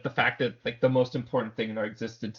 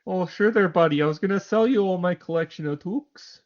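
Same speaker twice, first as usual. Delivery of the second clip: higher, louder mock Canadian salesman accent; exaggerated Canadian accent; 6 semitones higher, 4 dB louder than usual. Co-host pretends to sell his toques, hammed-up Canadian accent. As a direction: cheerfully hawk toques in a broad Canadian accent. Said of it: "There's the accent".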